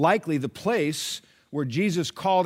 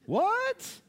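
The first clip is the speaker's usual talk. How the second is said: What? shot up very high in mock shock; mock disbelief. Preacher hearing "maybe if I feel like it", mock disbelief. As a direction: react like you can't believe your ears.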